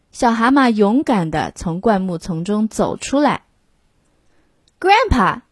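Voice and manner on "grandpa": high calling yell of Grandpa; little toad yelling to grandpa. A narrator's reading. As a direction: call out to Grandpa across the clearing. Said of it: "he yelled".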